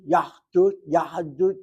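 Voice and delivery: "yahdut" repeated, easing down from an emphatic yah-dut; shifting pronunciation. Shown as a demonstration. Teacher demonstrating the drift for students, "yahdut" repeated and gradually relaxing. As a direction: say "yahdut" twice, the closed h starting to loosen. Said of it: "Watch my mouth".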